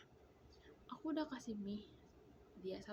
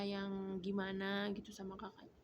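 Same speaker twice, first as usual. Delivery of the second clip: ordinary delivery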